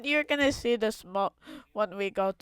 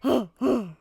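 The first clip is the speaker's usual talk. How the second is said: surprised gasp; 5 semitones higher, 7 dB louder than usual. Real, not acted. Gasp at gorillas.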